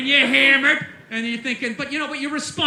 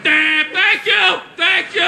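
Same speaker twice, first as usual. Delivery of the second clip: grateful yell; 5 semitones higher, 5 dB louder, slower than usual. Acted. Drunk yelling at drive-thru.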